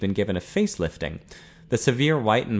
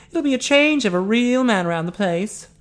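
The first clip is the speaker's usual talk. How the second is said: high, louder woman's voice; teasing Eileen; 11 semitones higher, 5 dB louder than usual. A narrator's reading. Eileen flattering Ted in front of Ian, teasing. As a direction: needle one man while flattering the other.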